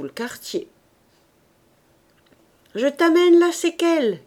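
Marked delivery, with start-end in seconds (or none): 2.7-4.2 loud, much higher cry; husband calling to his wife; called out. A narrator's reading. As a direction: announce it across the room. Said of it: "cria Coupeau".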